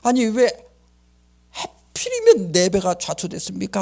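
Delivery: imitated indignant challenge, pitched high; protesting to God. Shipwrecked captain arguing with God, indignant protest. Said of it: "하고 따지는 거야".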